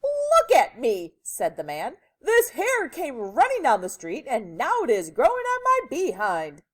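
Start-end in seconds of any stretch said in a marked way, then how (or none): 0.0-1.2 loud, high, slow cry; drawn-out dismay; distressed man. A narrator's reading. Distressed man shows himself. 2.2-6.6 loud, raised-pitch complaint; frantic, loud explanation; distressed man. A narrator's reading.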